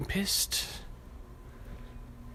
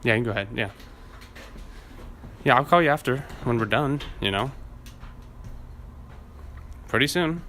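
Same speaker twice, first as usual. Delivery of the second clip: acting; 2 semitones higher than usual.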